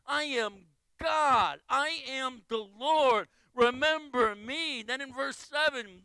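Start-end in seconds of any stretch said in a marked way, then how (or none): 0.0-4.8 slow, weighty and a bit louder; all-caps proclamation. Retold or imitated. Pastor reading God's all-caps words, slow and emphatic. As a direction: declare it like it's written in capitals. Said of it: "all caps".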